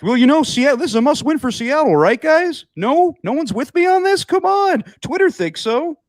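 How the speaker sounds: high, eager broadcaster fishing for agreement; over-hyped mock play-by-play voice, very high; Steve Levy. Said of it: "Steve Levy is just a hype beast".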